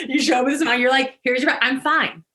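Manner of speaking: mock-cheerful laughing "I'm fine". Shown as a demonstration. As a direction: put on a chipper laughing front.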